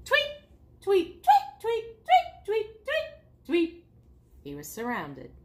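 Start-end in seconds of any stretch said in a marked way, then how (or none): 0.0-3.8 high, repeated chirps; piping birdsong imitation; birds tweeting. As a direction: chirp away like birds in a tree.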